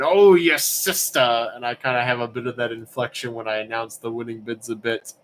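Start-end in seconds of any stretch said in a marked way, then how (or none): 0.0-1.5 louder and very slow, a mock stricken cry; quoting the Sopranos line. Joking about a catchphrase he and Angela repeat, hammed-up stricken cry. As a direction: ham up the dying gasp as a running joke. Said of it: "to going around saying".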